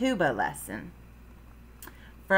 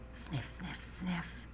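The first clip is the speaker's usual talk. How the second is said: whispered sniffs; 2 semitones lower, 16 dB quieter, slower than usual. A narrator's reading. Frogalina sniffs the air, whispered sniffing. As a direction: whisper each word like a little sniff.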